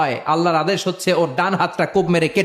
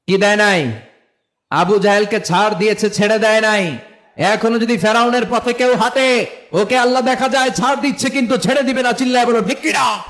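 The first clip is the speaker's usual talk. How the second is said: high, raised exhortation climbing steadily; full-force preaching; 3 semitones higher, 3 dB louder than usual. Real, not acted. Preacher warning followers of Pharaoh, rousing and high. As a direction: rouse the crowd to shout back.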